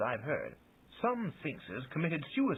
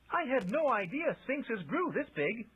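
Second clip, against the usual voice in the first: booming; 11 semitones higher than usual. A narrator's reading. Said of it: "he boomed".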